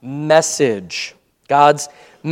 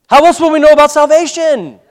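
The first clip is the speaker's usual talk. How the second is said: rising preacher's cry; 8 semitones higher, 4 dB louder than usual. Real, not acted. Preacher building to climax.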